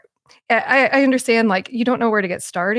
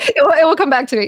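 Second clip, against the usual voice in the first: quick, higher, through a laugh; amused and hurried, laughing; 6 semitones higher, 4 dB louder, faster than usual. Real, not acted. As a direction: laugh off losing your thought. Laughing off a lost thought.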